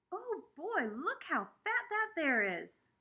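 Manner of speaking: high, loud, slow country holler. Acted. Rufus spotting a fat squirrel, hollering out high and slow. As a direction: call it out across the playground. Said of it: "hollered out".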